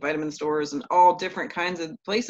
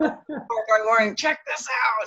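higher-pitched, playful mimicry of an enthusiast; lightly mocking eagerness, pitched up; imitated eager greeting; 5 semitones higher than usual. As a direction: mimic someone bursting to share a find. Mimics partner eagerly sharing research.

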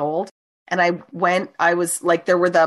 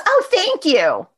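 smug thank you; 9 semitones higher, slower than usual. Retold or imitated. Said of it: "bitch who locked me in the closet goes".

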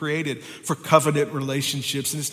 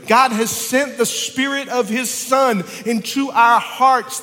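full-force preaching; 6 semitones higher than usual. Acted. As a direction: preach it like a revival.

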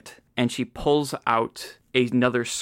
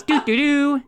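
whistled tune; 13 semitones higher than usual. Retold or imitated. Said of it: "and it goes".

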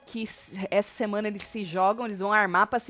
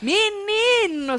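dramatic menino; 10 semitones higher, much slower than usual. Real, not acted. Gossip, dramatic exclamation.

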